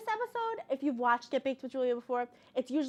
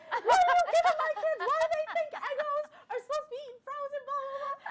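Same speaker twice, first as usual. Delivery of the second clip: high, loud mock yell with laughter; hammed-up furious dad, laughing through it; imitated yelling dad; 6 dB louder than usual. Dad yelling at mom about the kids' frozen waffles, mock shout. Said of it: "started screaming at my mother".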